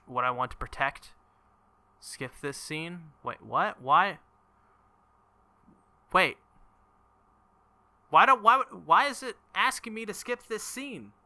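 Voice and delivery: normal talking voice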